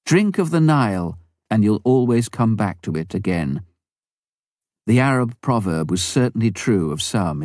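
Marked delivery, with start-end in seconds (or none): none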